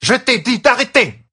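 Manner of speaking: loud, very high scolding yell; furious high-pitched command; angry shout. Acted. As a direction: shout it at a disobedient pupil. Enraged teacher yells while striking pupil.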